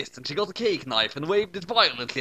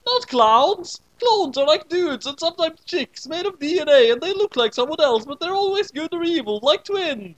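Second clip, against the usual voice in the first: exasperated explaining; 13 semitones higher, 5 dB louder than usual. Acted. Kid explaining clones loudly.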